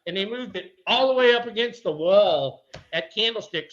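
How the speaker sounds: usual speaking voice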